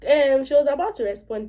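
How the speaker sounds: usual speaking voice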